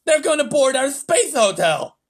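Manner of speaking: high, loud shout. A narrator's reading. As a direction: yell it out in alarm. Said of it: "shouted the president".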